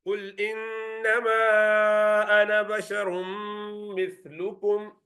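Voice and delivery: Quran recitation. Shown as a demonstration. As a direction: recite the verse melodically. Teacher recites verse.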